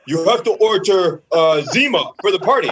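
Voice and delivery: loud, animated teammate impression; big, booming caricature of a teammate; George. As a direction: mimic an excitable teammate placing an order. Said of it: "George calls me that day".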